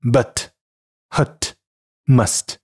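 American but, hut, must with central uh; American English demo of but, hut, must; American pronunciation. Shown as a demonstration. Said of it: "美式英语".

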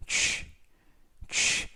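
"취" as the English ch ending; "취" shown twice, separately, for learners; sound demonstration. Shown as a demonstration. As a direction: isolate the final ch of search as "취". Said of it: "발음이 납니다".

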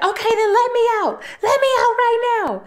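very high, fast fake-frightened squeal; acting scared. [name] in the car faking panic to get let out, very high squeaky fear. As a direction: play up the panic to fool him. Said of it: "get on her acting skills".

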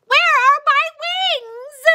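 high, loud alarmed cry; panicked surprise. Acted. Caterpillar cries out discovering he has no wings. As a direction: realize mid-air you can't fly.

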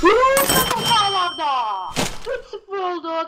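high excited play-by-play; commentator goal call. Pretend.

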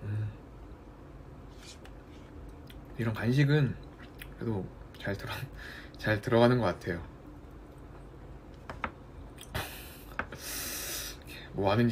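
speaking between spoonfuls; eating a snack while talking; mouth full of yogurt. Real, not acted. Seen 0: spoon in mouth at 0.2, then talks holding yogurt cup.

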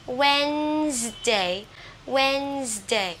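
"Wednesday" very slowly as "WENZ-day", silent d; pronunciation model. Shown as a demonstration. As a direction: draw out "Wednesday" in two syllables. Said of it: "hướng dẫn các bạn về cách phát âm". (đọc mẫu "Wednesday" thật chậm, hai âm tiết)

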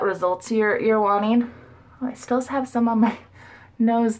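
talking while wiping face; muffled through towel. Real, not acted. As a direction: cloth pressed to mouth. Seen 0: washcloth pressed over mouth at 0.1-0.9 and 2.1, then moves to cheek.

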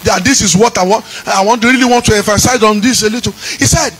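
shouted, sung preaching over the music; full-force preaching. Acted. Pastor calls down the God of vengeance in a fiery sung sermon. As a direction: preach it with fire and fury.

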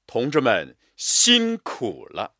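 辛苦 punched, slow and full; slow, stressed reading. Shown as a demonstration. Teacher reading aloud, weight on 辛苦. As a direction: lean hard on 辛苦. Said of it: "辛苦” 两个字也是加强我们的力度和音强".

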